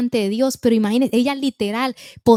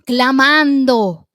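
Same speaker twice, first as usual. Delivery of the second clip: slow, loud, drawn-out "clamando"; "clamando" held long and loud like a cry; 6 dB louder, much slower than usual. Shown as a demonstration. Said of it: "dice la Biblia que a gritos".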